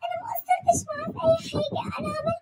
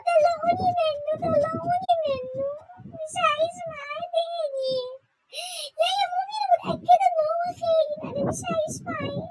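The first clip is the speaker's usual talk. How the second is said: hammed-up crying to her mother; wailing sob; 3 semitones higher than usual. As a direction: bawl it like a spoiled bride. Sobbing demand to mother.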